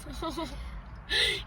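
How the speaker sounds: soft, high giggle; amused laugh. Real, not acted. Friend reacting to a skinny jeans complaint, amused giggle. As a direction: let a light real laugh slip out.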